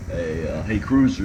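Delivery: normal talking voice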